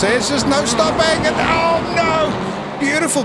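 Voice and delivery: sudden high excited yelp at the crash; rising alarm as the car flips; excited commentary. Real, not acted. Race caster yelping as a car rolls.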